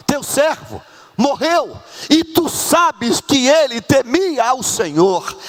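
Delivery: high, slow, stretched-out lament; impassioned plea. Acted. Preacher voicing the widow before the prophet, impassioned plea. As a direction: plead it with drawn-out words.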